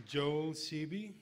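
loud, slow name call. Real, not acted. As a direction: announce each name slowly to the hall. Calling inductees' names.

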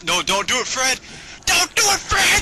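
high, loud yell building to a scream; play-acted desperate plea; mock frantic shout. Mock pleading with a game character.

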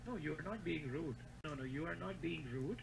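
ordinary talk, nothing marked

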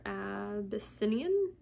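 slow, soft, questioning "Abyssinian"; tentative sounding-out. Real, not acted. Reader unsure of a plant name, tentatively. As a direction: try "Abyssinian" slowly, rising like a question.

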